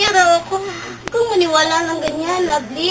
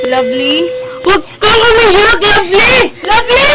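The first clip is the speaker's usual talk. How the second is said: tearful pleading; 3 semitones higher, 8 dB louder than usual. Acted. Friends plead with crying girl.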